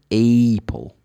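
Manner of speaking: "Apple" with a long letter-name A; wrong pronunciation as AY-pul. Shown as a demonstration. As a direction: stretch "Apple" into "AAAY-pul". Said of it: "but never Apple".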